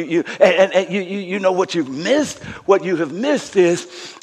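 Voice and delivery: ordinary talk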